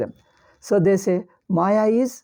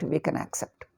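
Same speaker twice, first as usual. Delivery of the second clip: unvoiced aside; 3 semitones lower, 9 dB quieter than usual. Real, not acted. Quiet aside conceding a point.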